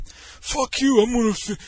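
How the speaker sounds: high, loud, dragged-out mock-drunk protest; imitated slurry-headed indignation; drunken defiance. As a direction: defy them like a stubborn drunk. Acted drunk friend refusing to obey.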